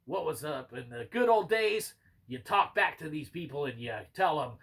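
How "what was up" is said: ordinary delivery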